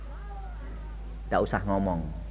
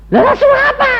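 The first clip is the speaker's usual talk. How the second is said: much higher, louder rising protest; protes heboh; 5 dB louder, much faster than usual. Retold or imitated. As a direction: throw it up in disbelief. Mengulang protes kepada Tuhan. (protes dengan suara jauh lebih tinggi dan keras)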